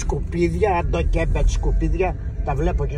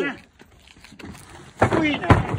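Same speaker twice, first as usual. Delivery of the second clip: high-pitched angry demand; angry shout; 10 semitones higher, slower than usual. Real, not acted. Grandfather demanding to know where it is, angry high shout. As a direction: call out sharply, demanding an answer. Old man angrily confronting someone.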